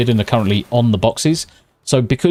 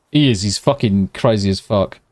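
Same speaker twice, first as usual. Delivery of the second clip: normal talking voice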